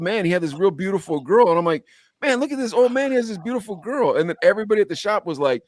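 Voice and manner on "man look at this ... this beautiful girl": high-pitched retold amazement; amazed. Telling the shop about the old man, amazed. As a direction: marvel at it in a raised pitch. Retelling his amazed reaction to video.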